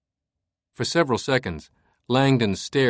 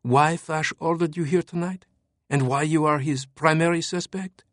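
put-on higher, urgent young woman; urgent woman's voice; 6 semitones higher than usual. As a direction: press him with urgency. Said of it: "her eyes urgent".

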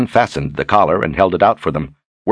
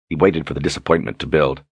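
ordinary delivery

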